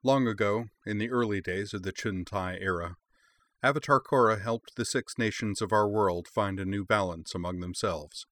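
grand, measured legend narration; epic fantasy narrator. A narrator's reading. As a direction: tell the ancient lore like an epic prologue. Opening lore narration before title.

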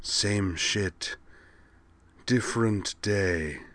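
soft, slow, offhand alien voice. A narrator's reading. The Vorlon answering an old friend, offhandedly. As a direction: toss it off in an eerie hush. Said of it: "said the Vorlon offhandedly".

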